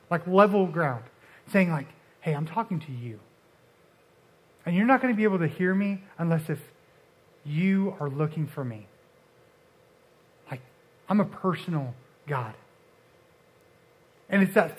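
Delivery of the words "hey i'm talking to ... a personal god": much softer, intimate and calm; gentle whisper voice. Retold or imitated. God speaking to Elijah face to face, softly. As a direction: speak it eye to eye, close and soft. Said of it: "this gentle whisper".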